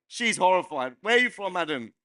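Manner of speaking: ordinary talk, nothing marked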